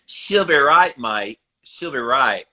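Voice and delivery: a light Australian lilt on "She'll be right, mate"; Australian phrase. Retold or imitated. Host quoting the Australian saying, lightly accented. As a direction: toss it off like an easygoing Aussie. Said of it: "the fun phrase that they say is".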